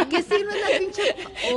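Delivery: laughing high through the question. Real, not acted. Host asks the guest's sign while laughing hard. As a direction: laugh the words out.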